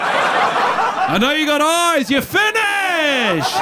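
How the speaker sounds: high, loud, slow mock threat; hammed-up menacing shout at an elevator stranger. Acting out elevator stare.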